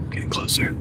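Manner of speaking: hushed mock hunter whisper. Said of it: "I gotta use my deer hunter voice".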